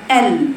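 ال with the tongue spread flat; clean, flat-tongued L in ال; correct flat-tongue lam. Shown as a demonstration. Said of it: "انت فاردة لسانك".

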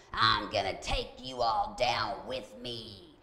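slow, altered demonic voice; imitated demon voice. Demon speaking through a sick woman to her family, slow imitated demon voice. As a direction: intone a drawn-out threat like a demon. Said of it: "a voice came out of her that was not her".

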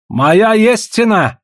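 high, drawn-out insistent cry; exclaiming. A narrator's reading. Trader insisting he has a price, exclaiming. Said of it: "воскликнул Гал".

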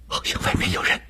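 tense, breathy whisper. A narrator's reading. Woman warning the others of someone outside, hushed. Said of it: "她压低嗓门说".

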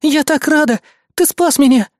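high, gushing rescued damsel; damsel in distress. A narrator's reading. Азуми hugging her rescuer, gushing damsel. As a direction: gush in a high, grateful voice. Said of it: "включив типичную даму в беде".

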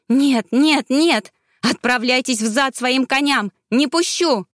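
high, loud desperate cry; desperate refusal. Acted. Ravena refuses, clinging desperately. (отчаянный, громкий крик девушки)

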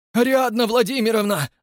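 high, loud desperate appeal; frantic plea. A narrator's reading. Rastopchin pleading with Ariadna, frantic. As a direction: beg her frantically. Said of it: "бешено взмолился Растопчин-старший".

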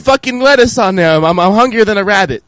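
high, put-on Italian accent; mock Italian customer. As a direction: bark the order like a rude diner.

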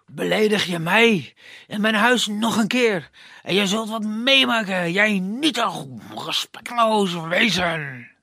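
loud, menacing forest spirit; heavy threatening voice. Acted. Forest spirit threatens the prince, heavy and menacing. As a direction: threaten the puny intruder. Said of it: "dreigt met zware, dreigende stem".

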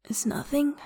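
hushed, holding yourself together; thick-mouthed. A narrator's reading. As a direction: brush it off through a thick mouth. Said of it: "He sounds thick-mouthed".

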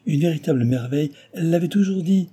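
high, rising effusive delivery; lavish praise on a favourite pupil; mounting enthusiasm; gushing praise. A narrator's reading.

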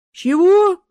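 loud, high drawn-out "what?"; puzzled asking back. A narrator's reading. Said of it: "переспросил Ангел".

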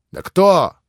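high, loud near-savage shout; rising fierce yell of disbelief; fierce cry. A narrator's reading. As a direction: hurl the question at him furiously. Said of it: "уже почти свирепо вскричал Иван".

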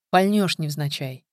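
ordinary delivery

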